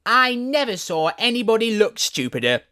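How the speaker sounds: sudden rude violet. A narrator's reading. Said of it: "said so suddenly".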